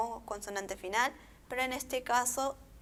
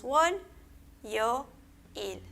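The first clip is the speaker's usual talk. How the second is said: "wol, yo, il" with a clear break after each syllable; much slower than usual. Shown as a demonstration. Teacher showing each sílaba, "wol, yo, il" separated by pauses. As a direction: isolate "wol", "yo" and "il". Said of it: "Vamos a leer por sílaba".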